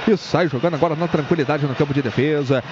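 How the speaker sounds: play-by-play. Real, not acted. Live play-by-play.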